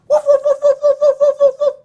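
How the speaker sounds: loud, high yappy woofs; excited dog barking. Acted. Dog seeing the ball, loud excited yapping. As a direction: bark like an overexcited little dog. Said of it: "barking excitedly".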